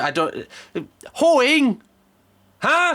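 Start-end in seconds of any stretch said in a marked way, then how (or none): 1.1-2.9 hoeing? high and loud in disbelief; shocked disbelief. Real, not acted. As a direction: gasp out the word like a scandal.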